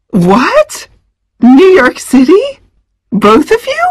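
high, startled mother's outcry; rising disbelief at each question; exclaiming. Acted. Mom reacts to the kids' trip news, stunned. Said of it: "Missus Martin exclaimed".

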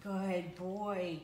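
slow, soothing dog praise; praising a dog. Real, not acted. Praising the dog after recall.

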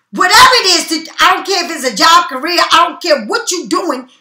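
ordinary talk

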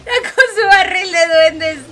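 laughing cry. Real, not acted. Streamer laughing at the goblin barrel, loud laughing cry. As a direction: crack up while complaining.

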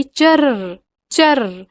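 drawn-out ripping chur chur; slow tearing sound. A narrator's reading. Narrator voicing the river splitting under the raft, slow ripping sound. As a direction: stretch it out like the riverbed slowly cracking.

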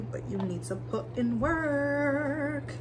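slow, drawn-out advice; slow preachy emphasis. Real, not acted. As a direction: stretch each word like a sermon. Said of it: "I'm talking like this".

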